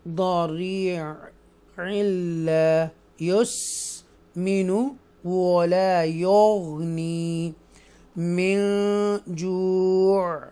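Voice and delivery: slow melodic tajweed chant, the last word very drawn out; joined recitation. Shown as a demonstration. Teacher shows students the joined reading, slow melodic chant. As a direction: chant it flowing on, stretching the final जू'. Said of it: "तो फिर पढ़ेंगे".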